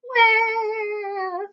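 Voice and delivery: high, soft crying wah; a small high crying wail; bear wail. A narrator's reading. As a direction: whimper like a hurt cub. Bear cries over splinter.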